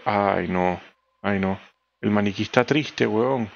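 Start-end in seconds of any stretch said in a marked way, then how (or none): none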